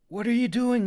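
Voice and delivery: high, loud alarmed cry. A narrator's reading. Clove crying out to Kita outside the tent. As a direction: shout back at the lingering girl. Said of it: "he cried".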